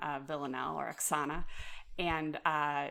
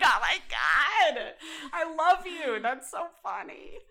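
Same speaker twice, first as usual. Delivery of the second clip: delighted gush; 9 semitones higher, 6 dB louder, slower than usual. Retold or imitated. Her delighted reaction retold.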